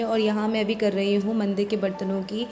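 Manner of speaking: ordinary talk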